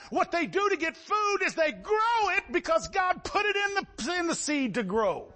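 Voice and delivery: high, forceful pulpit delivery; fervent preaching. Real, not acted.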